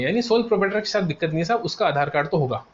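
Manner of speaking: normal talking voice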